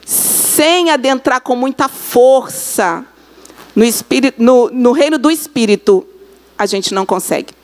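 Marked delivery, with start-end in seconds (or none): none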